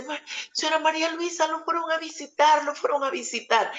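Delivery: high, quick and excited; excited daughter. Retold or imitated. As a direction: she bursts in overjoyed with good news. Verónica returns happy with news. (hija contenta y emocionada, voz aguda)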